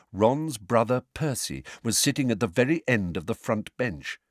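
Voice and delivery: ordinary delivery, nothing marked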